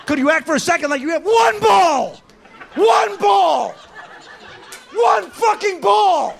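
high-pitched, strained comic rant; exasperated yell. Acted. Comic berating the fussy customer, exasperated yell.

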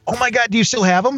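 high, quick burst of amazement; excited surprise. Real, not acted. Radio colleague hears about rescued old records, real excited surprise. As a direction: jump up in pitch, eager to know.

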